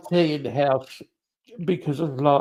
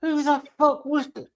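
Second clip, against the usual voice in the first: baffled; 12 semitones higher than usual. Retold or imitated. Confused reaction to waving.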